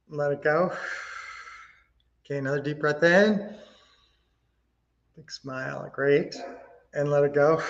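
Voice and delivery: soft, calm breathing guide; guided breathing. Acted. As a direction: talk them gently through breaths.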